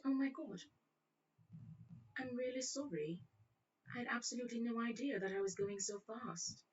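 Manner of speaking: high, soft British apology; British accent. Retold or imitated. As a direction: plead innocence in mock British. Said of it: "broke in a one British accent".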